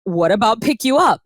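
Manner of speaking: put-on quoting a mentor in a South African accent. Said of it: "in a South African accent".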